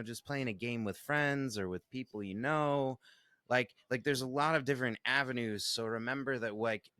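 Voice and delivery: ordinary talk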